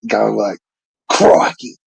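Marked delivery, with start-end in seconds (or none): 1.1-1.8 a quick, lightly hammed-up Aussie exclamation, pitched higher; imitating an over-the-top Aussie accent. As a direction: toss off an Aussie "Crikey" like a mocking imitation. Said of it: "someone doing an Aussie accent".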